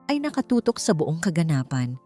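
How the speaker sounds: usual speaking voice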